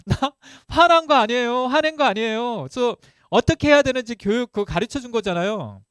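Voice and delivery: loud, high, indignant protest; angry denial. Retold or imitated. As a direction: insist heatedly that you are not angry. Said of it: "화가 나는 거예요".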